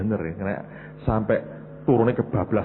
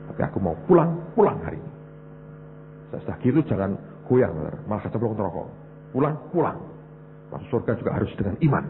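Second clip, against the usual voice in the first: ordinary talk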